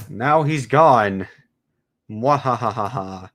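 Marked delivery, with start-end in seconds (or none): 2.1-3.3 slow, drawn-out villain laugh; evil cackle. Pretend. Streamer gloating over removing a character, slow evil cackle. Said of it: "I am so evil".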